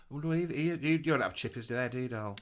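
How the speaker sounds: ordinary talk, nothing marked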